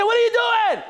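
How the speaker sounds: high shouted prompt for the room; exasperated shout. Acted. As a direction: yell it for everyone to echo. Leading group in repeating challenge.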